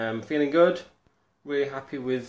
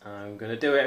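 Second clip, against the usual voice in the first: ordinary talk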